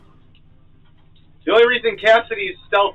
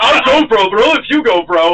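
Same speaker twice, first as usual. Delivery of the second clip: laughing, loud buddy-movie bro; action movie bro; 8 semitones higher, 7 dB louder than usual. Acted. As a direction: ham up a nineties action hero.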